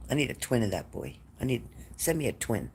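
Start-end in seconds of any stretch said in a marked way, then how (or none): none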